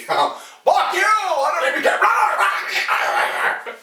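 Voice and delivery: high, slow, yelled fuck you; shouted outburst. Retold or imitated. Barber screaming at the customer, reckless shout. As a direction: scream it with nothing to lose. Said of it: "recalled outburst, shout heard".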